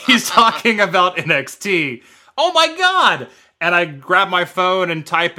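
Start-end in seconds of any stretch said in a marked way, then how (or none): 2.4-3.3 much higher, a burst of recognition; Oh my God, it finally clicks; sudden realization. Real, not acted. Teller realizing who the stranger is, high surprised burst.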